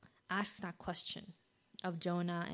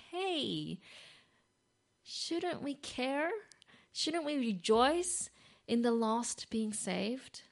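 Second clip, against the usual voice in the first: earnest appeal; 5 semitones higher, 3 dB louder, much slower than usual. Acted. God's loving appeal, voiced.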